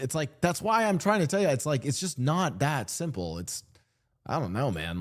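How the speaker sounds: normal talking voice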